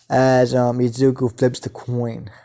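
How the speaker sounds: plain reading voice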